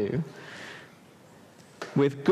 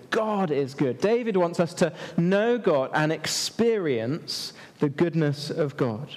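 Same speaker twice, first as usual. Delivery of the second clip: usual speaking voice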